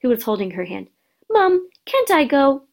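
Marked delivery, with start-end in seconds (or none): 1.3-2.6 put-on high, slow little girl's plea; small girl pleading. A narrator's reading. Youngest daughter begging mother to go, high slow whine. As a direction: beg your mum in a high little voice.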